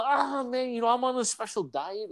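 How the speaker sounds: high, softening, pained excuse; sheepish mock excuse. Fasting guest explaining why he isn't eating, sheepish. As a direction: make an awkward excuse, hammed up.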